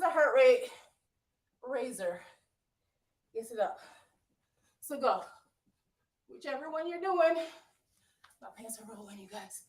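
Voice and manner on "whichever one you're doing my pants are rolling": usual speaking voice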